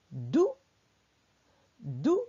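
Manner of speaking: "dou" cut short, not held; short vowel. Shown as a demonstration. Teacher dictating a syllable to write, "dou" kept short. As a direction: say a brief, low "dou" with no stretch. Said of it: "elle n'est pas tirée".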